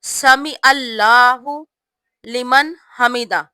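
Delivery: slow, each word of سمع اللہ لمن حمدہ given its full weight; slow clear recitation. Shown as a demonstration. Teacher showing the right way, slow and clear. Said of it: "اچھے طریقے سے یاد رکھیں".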